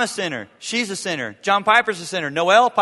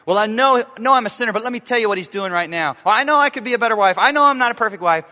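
louder, fast and higher mock spouse; a spouse brushing past their own fault, hammed fast; mock excuse; 5 semitones higher, 6 dB louder, faster than usual. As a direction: rattle off a defensive excuse. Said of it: "our conversations begin with".

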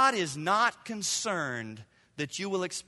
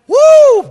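loud, high joyful "woo"; shouted "woo"; excited whoop; 19 dB louder than usual. Real, not acted. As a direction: let out a whoop of delight. Excited whoop mid-sermon.

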